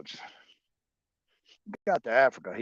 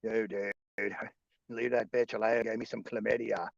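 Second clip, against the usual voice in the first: usual speaking voice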